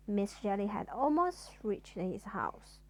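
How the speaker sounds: ordinary delivery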